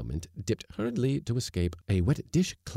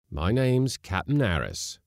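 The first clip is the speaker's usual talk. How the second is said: stiffly offended, raised voice; stiff correction; 6 semitones higher, 5 dB louder than usual. A narrator's reading. Skipper correcting the cook on his title, stiffly. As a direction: pull rank with a stiff, higher-pitched protest. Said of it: "said the skipper stiffly".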